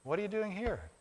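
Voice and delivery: soft, hushed question; gentle whisper. Acted. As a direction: ask it gently, barely above a breath. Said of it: "in a gentle whisper".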